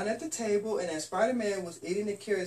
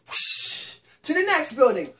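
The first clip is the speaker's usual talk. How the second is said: loud excited whooshes, each swing higher; swinging whoosh sounds; 10 semitones higher, 11 dB louder than usual. A narrator's reading. Storyteller whooshing Spider-Man across buildings, louder and higher. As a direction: swoosh from building to building, building up.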